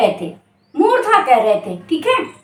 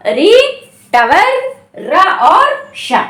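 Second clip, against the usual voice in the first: slow listing; 3 dB louder, much slower than usual. Shown as a demonstration. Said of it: "इनका उच्चारण क्या".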